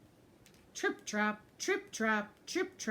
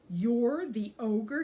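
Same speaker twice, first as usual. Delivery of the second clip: slow, louder jeer; 3 semitones higher, 8 dB louder, much slower than usual. Acted. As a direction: smallest goat mocks the little ogre, slow jeering question. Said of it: "he jeered".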